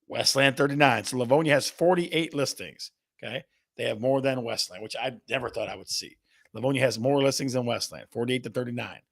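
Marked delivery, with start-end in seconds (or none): none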